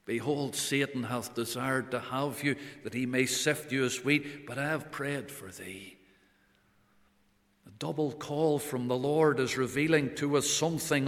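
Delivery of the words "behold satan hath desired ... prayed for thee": soft solemn warning. Acted. The Lord warning Peter, quoted.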